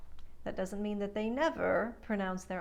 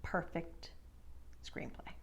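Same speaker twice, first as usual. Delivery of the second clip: soft whispered aside; whispered praise; 3 semitones lower, 10 dB quieter than usual. Real, not acted. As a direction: breathe it as an aside.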